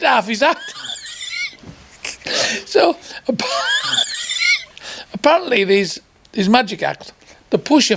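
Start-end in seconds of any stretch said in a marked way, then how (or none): 0.8-1.7 high, breathless burst of laughter; real laughter. Real, not acted. Teller cracks up at story. 3.5-4.6 high, breathless burst of laughter; real laughter. Real, not acted. Teller cracks up at story.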